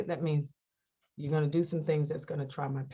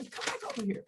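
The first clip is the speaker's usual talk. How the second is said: hushed, breathy little plea; coaxing whisper; 4 semitones higher, 9 dB quieter, much faster than usual. Acted. Asking him to come back over, soft whispered coaxing.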